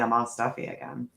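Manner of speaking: ordinary talk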